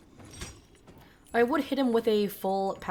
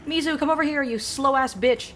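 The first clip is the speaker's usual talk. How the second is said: impatient yelling; 5 semitones higher, 6 dB louder than usual. Real, not acted. Yelling at the pet.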